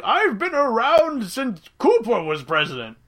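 slow, high, grandiose boast; boastful old-timer. Retold or imitated. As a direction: brag like an ancient immortal. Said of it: "It's like".

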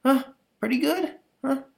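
put-on boy begging mom for praise; cheeky little boy. Boy brags to soaked mom.

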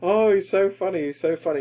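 mocking the fans. Mocking Jimmy Carr's fans.

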